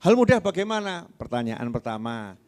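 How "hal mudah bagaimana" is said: higher, louder disbelieving ask; indignant question. Retold or imitated. Wife challenges the teacher, higher and louder in disbelief. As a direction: push back in disbelief.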